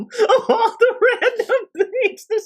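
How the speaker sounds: high-pitched, laughing incredulity; laughing disbelief. Retold or imitated. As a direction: crack up while protesting in a squeal. Baffled reaction to random opening quote.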